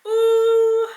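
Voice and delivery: loud, high-pitched "ooh", nearly crying; tearful high wail. Real, not acted. Vlogger dreading the sea moss, tearful high wail. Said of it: "I'm about to cry".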